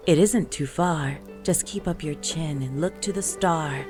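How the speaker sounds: ordinary delivery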